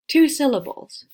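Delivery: high, loud cheer; excited answer. Shown as a demonstration. Teacher cheers the answer for students, high and loud. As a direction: announce it like a reveal. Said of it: "answer praise".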